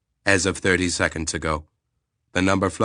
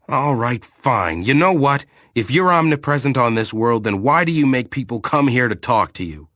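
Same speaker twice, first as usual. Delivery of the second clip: higher, louder pointed challenge; exasperated challenge; 6 semitones higher, 5 dB louder than usual. Acted. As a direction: push back, fed up. Eric glares, challenging the figure.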